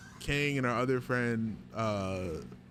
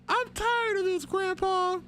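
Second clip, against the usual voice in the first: high, strained whiny voice; meme voice; 4 dB louder than usual. Acted. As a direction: squeal it out, pitched way up. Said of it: "I'm saying it in three".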